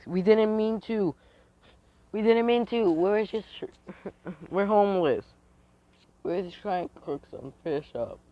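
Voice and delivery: put-on high, whiny child's plea; pleading kid. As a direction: beg in a high little voice. Kids plead in the story.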